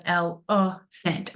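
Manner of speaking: elephant broken slowly into el, uh, fent; schwa shown. Shown as a demonstration. Teacher revealing the schwa to students, elephant slowly split with middle uh. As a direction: say elephant syllable by syllable with a clear uh in the middle. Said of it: "it's that uh sound in that schwa".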